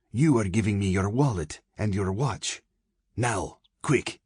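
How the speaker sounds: lightly voiced urgent demand. A narrator's reading. Girl robbing man at knifepoint.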